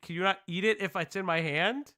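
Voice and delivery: ordinary talk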